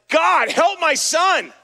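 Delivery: anguished shout for help, drawn out slowly; desperate cry. Retold or imitated. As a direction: cry out to God for your sick son. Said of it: "I am just crying out to God".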